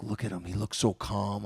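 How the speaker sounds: envious murmur. Retold or imitated. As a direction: mutter it about your rival. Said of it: "And I'm like".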